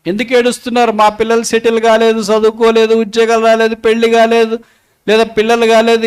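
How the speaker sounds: high, insistent sermon listing; forceful preaching. Real, not acted. Preacher lists the congregation's reasons for weeping, forceful and insistent. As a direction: press each reason on them, high and driving.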